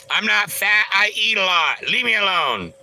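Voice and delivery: high, loud, hurt protest; mock offended whine. Reading a fat comment, mock offended whine. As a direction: play wounded and push them away.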